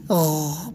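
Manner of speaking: غ as a long low gargled rasp; drawn-out throaty غ; from the throat. Shown as a demonstration. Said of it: "حلق سے پڑھیں گے".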